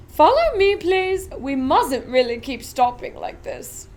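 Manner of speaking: a giddy chocolatier showing off; Willy Wonka, grand and eager; eccentric Wonka. Acted. Wonka voice continues.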